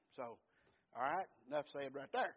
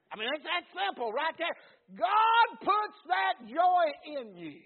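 full-force preaching; 11 semitones higher, 14 dB louder than usual. Real, not acted. Preacher tells the congregation who gives joy, full-force preaching. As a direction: belt it out to the congregation.

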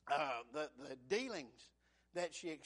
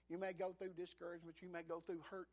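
ordinary talk, nothing marked

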